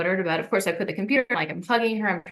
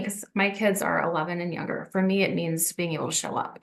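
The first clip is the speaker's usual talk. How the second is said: ordinary delivery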